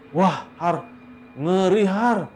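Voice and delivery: high, loud spooked report; storyteller playing a freaked-out man; acted horror. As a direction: blurt it out horrified, pitched well up. Anto reports horror to his friend.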